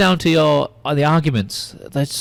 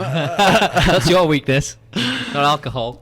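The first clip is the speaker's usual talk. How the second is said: slow teasing words through laughter; laughing; 5 semitones higher, much slower than usual. Real, not acted. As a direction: tease him while laughing.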